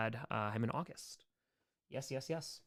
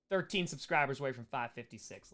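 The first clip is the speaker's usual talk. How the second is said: ordinary talk, nothing marked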